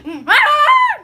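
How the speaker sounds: hammed-up piercing holler; sudden sustained high shriek; high yell. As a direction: hum bursting into a loud, high scream. Yell inside comic scene.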